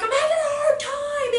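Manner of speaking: high, loud mock wail; imitated shout. Boy in behavior class shouting, imitated high and loud. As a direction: shout it as an upset child. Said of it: "But the shout isn't like".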